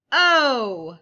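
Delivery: sudden loud letter call. Acted. Calling out letters in game.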